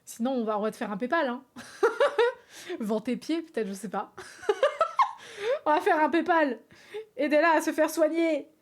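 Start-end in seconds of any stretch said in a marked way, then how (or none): 1.5-2.7 a sudden high-pitched burst of laughter; high squealing laugh. Real, not acted. Streamer laughing at her own joke: high squeal of laughter. 4.2-5.6 a sudden high-pitched burst of laughter; high squealing laugh. Real, not acted. Streamer laughing at her own joke: high squeal of laughter.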